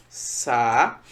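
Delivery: "Sa-u-de" in three slow, separate beats; split into syllables. Shown as a demonstration. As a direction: pause after each syllable of "Sa-u-de". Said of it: "Como é que eu separo saúde?".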